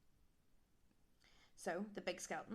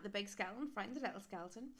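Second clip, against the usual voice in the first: usual speaking voice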